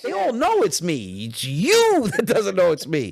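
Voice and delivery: high, loud, drawn-out mock exasperation; exasperated insistence. Comedian setting a confused old fan straight, retold in loud exasperation.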